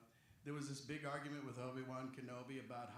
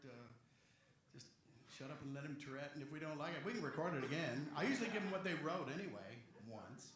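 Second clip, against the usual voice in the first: ordinary delivery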